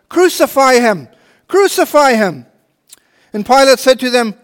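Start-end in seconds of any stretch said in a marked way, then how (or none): 0.1-2.5 raised, higher mob cry; crowd crying out. Acted. Chief priests crying out to Pilate, raised mob shout. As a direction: call for his death like the angry crowd. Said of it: "they cried out".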